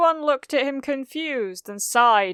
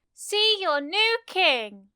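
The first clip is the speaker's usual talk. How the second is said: high, loud, slow announcement; grand proclamation; 8 semitones higher, 6 dB louder, slower than usual. Acted. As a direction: proclaim it like Lion King. Mina presents cat.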